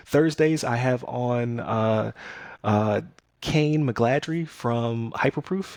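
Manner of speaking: normal talking voice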